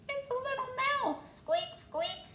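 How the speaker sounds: very high tiny mouse voice; little mouse. Acted. The little mouse answering from the pillow. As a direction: squeak it small and sweet.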